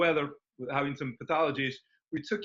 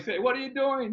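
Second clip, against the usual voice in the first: high-pitched freaked-out question; retold alarm; 10 semitones higher than usual. Onlooker challenging the doctor mid-treatment, alarmed.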